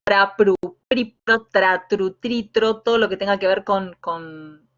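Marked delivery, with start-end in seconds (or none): 0.0-2.8 pra, pru, pri, pro, tra, tru, tri, tro clearly articulated one by one; exaggerated syllable drill. Shown as a demonstration. Teacher demonstrating the R drill to students. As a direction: snap out each syllable. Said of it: "pronunciando bien cada una de las vocales".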